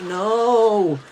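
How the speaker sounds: loud, high, drawn-out "no"s; high-pitched, stretched-out shouted objection; whole class protesting. Retold or imitated. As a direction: yell like a roomful of kids correcting the teacher. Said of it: "The whole class be like".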